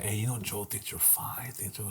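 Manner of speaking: very soft, slow confiding murmur; imitated gossip, barely voiced; retold hushed matchmaking. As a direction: pass on a secret under your breath. Retold friends secretly telling her.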